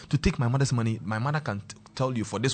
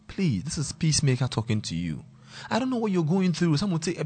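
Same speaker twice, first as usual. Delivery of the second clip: usual speaking voice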